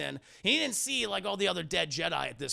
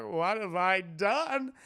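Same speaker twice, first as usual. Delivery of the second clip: slow, drawn-out lament; mock remorse; 3 dB louder, much slower than usual. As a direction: stretch it out like a melodramatic hero. Mimics Anakin kneeling in remorse.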